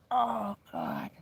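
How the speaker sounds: ordinary talk, nothing marked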